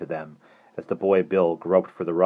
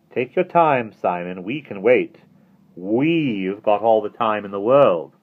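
louder, leisurely mockery; taunting; 3 semitones higher, 6 dB louder, slower than usual. A narrator's reading. Withers lets the trapped children wait, taunting. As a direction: stretch the last words out smugly.

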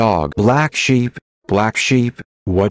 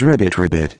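a lower, playful ribbit like a frog; croaking frog; 4 semitones lower, faster than usual. Pretend. Child voicing the frog in a picture book, croaking ribbit. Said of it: "Frog goes".

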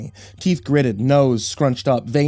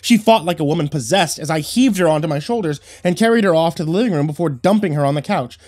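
usual speaking voice

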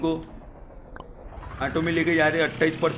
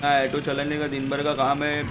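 ordinary talk, nothing marked

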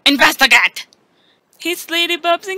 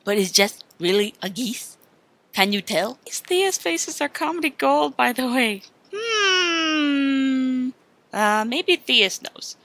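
ordinary talk, nothing marked

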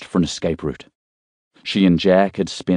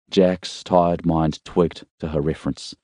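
ordinary delivery

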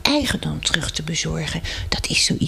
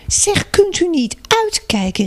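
much higher, indignant cry; angry call; 10 semitones higher than usual. Acted. Mother scolding the blind-pretending man, angrily. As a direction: snap at him to watch out. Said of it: "riep de moeder boos".

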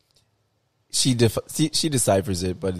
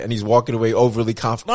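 ordinary talk, nothing marked

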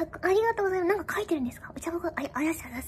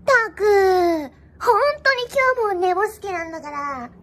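声を張り、ゆっくり伸ばす世話焼き妹; 大げさに呆れてみせる妹芝居; 妹キャラ; 3 semitones higher, 5 dB louder than usual. Acted. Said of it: "これ妹キャラじゃない". (わざとらしい妹声)

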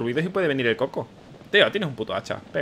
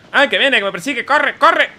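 being chased; 9 semitones higher, 6 dB louder, slower than usual. Real, not acted. Chased by the killer in game.

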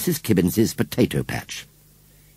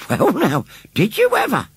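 put-on high-pitched old lady's astonishment; exclaiming; 13 semitones higher than usual. A narrator's reading. Missus Kibbins hears about the robin's nest, exclaiming. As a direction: marvel at the news. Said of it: "exclaimed Missus Kibbins".

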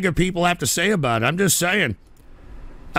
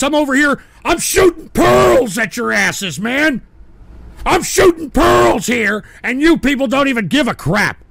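frustrated rant; 6 semitones higher, 5 dB louder than usual. Real, not acted. Streamer ranting at chat for ignoring him. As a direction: yell that nobody appreciates you.

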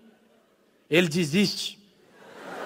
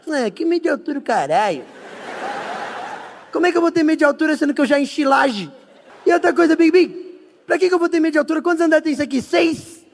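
higher-pitched mock protest; indignant friend; 7 semitones higher than usual. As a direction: deny it hotly, imitating a buddy. Tigas nega o medo, encenado. (amigo indignado, voz mais aguda)

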